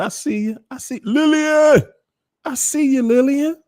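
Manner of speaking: high, delighted calling out; excited greeting. Real, not acted. Host spotting Lillian in chat, high excited call. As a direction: sing out the hello.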